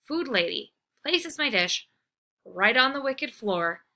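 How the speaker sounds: plain reading voice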